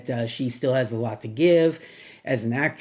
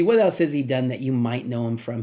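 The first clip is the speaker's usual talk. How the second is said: normal talking voice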